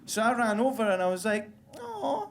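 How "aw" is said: high cooing voice, much higher; gushing sympathy for the deaf dog, very high; sweet gushing. Retold or imitated. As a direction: coo like you are talking to a puppy.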